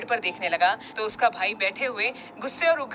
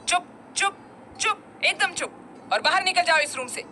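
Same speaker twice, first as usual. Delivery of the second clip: high angry shout of silence; 7 semitones higher than usual. A narrator's reading. Angry brother shouting his brother out of the room. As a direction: yell at him to shut up and get out. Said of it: "चिल्लाते हुए बोला".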